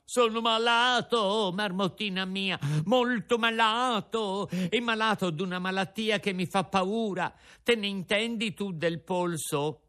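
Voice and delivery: a sick little puppet pleading; frightened Pinocchio. A narrator's reading. Pinocchio tells the marmot he is very ill, frightened.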